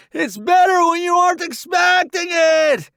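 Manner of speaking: high, loud yell on the run; shouting over shoulder. A narrator's reading. Roddy calling back to newbie as they charge, shouting. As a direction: holler back while running. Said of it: "he shouts over his shoulder".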